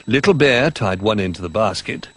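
ordinary delivery

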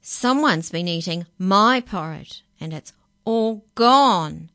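loud, rising complaint, slowing on "all gone"; Baby Bear's wail. A narrator's reading. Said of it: "Then Baby Bear picked up his bowl and said".

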